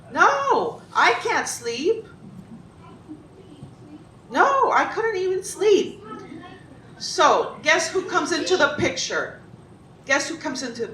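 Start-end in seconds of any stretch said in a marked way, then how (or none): 0.1-6.0 high, emphatic dramatic refusal; mock alarm. Storyteller imagining her head at stake, high mock-alarmed refusal. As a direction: cry out no, then stretch it high. Answers her question as if threatened.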